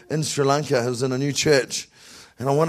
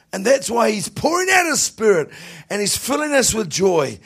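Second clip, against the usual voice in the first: preaching at full force; 7 semitones higher than usual. Real, not acted. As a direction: preach it to the back row.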